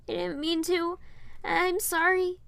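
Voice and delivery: put-on high, childlike, a touch of tears; a small apology through tears; tearful. A narrator's reading. Piper apologizes to Rapunzel, in a high tearful voice. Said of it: "I said in tears".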